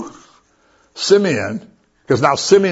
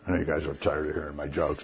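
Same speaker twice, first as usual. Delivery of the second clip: much softer, quick throwaway aside; quiet aside; 10 semitones lower, 13 dB quieter, faster than usual. Real, not acted. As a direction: tuck it away quietly and fast. Self-deprecating aside to congregation.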